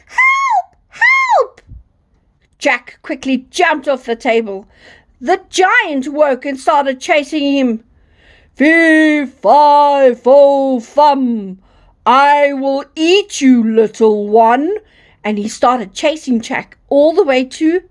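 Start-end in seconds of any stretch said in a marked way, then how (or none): 0.1-1.6 very high, squealing cry for help; screaming harp. A narrator's reading. Magic harp being stolen by Jack, high-pitched mock scream. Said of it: "the harp started screaming". 8.5-14.8 loud, drawn-out booming chant; menacing giant. A narrator's reading. Giant waking to chase Jack, loud menacing chant.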